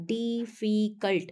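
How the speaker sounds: डिफिकल्ट split into three separate beats, di-fi-cult; broken into syllables. Shown as a demonstration. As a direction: sound out डिफिकल्ट piece by piece for students. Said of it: "टुकड़ों में बोल रहे हैं ना हम". (डिफिकल्ट को तीन टुकड़ों में तोड़कर बोलें, डि-फि-कल्ट)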